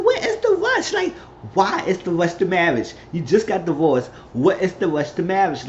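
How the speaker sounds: high-pitched retold disbelief; zdumienie. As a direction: question the rush in mild mock astonishment. Retelling own incredulous reaction to marriage.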